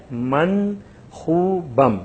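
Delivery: من خوبم read slowly, each syllable clear; slow Persian model. Shown as a demonstration. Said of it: "ہم تلفظ کر رہے ہیں". (من خوبم کو آہستہ اور صاف تلفظ سے)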